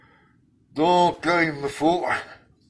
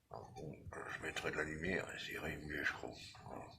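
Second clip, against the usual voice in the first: whispered aside to himself; whispering; 5 semitones lower, 13 dB quieter than usual. Real, not acted. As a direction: drop to a whisper. Aside to himself while working. (chuchoté)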